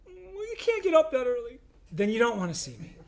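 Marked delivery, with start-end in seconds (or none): 0.0-1.6 mock excuse. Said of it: "who knows what happens after church".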